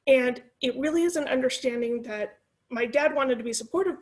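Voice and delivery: ordinary talk, nothing marked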